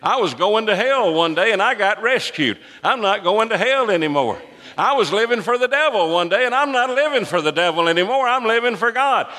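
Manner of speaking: impassioned shout climbing very high; preaching shout. Real, not acted. Preacher telling congregation he was rescued from hell, shouting. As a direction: build into a high, testifying shout. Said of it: "I have a reason to shout".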